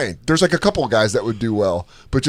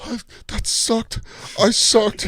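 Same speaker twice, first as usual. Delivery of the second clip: put-on high, breathy little-kid whimper; small defeated child's voice; mock kid whimper; 11 semitones higher, slower than usual. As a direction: whimper it like a beaten six-year-old. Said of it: "and I was like".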